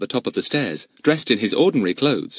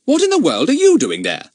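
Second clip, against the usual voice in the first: startled; 6 dB louder than usual. Acted.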